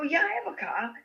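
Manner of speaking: put-on high, eager teen reply; squeaky excited answer; eager yes. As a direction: blurt it out, thrilled. Her young self answering.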